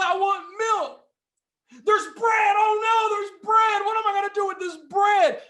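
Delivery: high, loud hammed-up freak-out; mock panic. Speaker playing a panicked shopper overwhelmed by aisles, hammed-up shouting. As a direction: shriek at each aisle in mock horror. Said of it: "do you freak out because of all the options".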